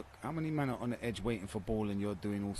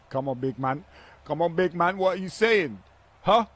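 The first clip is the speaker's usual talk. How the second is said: taunting shout; 9 semitones higher, 14 dB louder than usual. Real, not acted. Taunting opponent after a clip.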